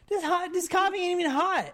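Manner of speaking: high-pitched mock whining complaint; retold complaining. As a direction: gripe like a hammed-up drunk husband. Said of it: "he complains that the coffee".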